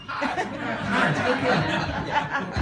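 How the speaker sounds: very high, drawn-out singer caricature; hammed-up high mimicry, slowing down. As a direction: stretch out a silly high phone greeting. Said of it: "I do not talk like that".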